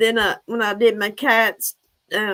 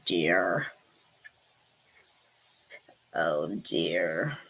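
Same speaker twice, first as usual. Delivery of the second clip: deep pitched-down character voice; Jody Sue voice; 12 semitones lower than usual. Acted. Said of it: "I'm Jody Sue".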